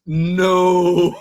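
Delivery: a loud laughing "No" in disbelief; shocked laughing shout. Real, not acted. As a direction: burst out laughing at the twist. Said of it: "Oh, that is a twist".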